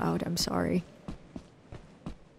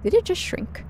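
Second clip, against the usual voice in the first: high, louder shocked question; genuinely startled asking; surprised; 11 semitones higher, 4 dB louder than usual. Real, not acted. Surprised at shrinking object.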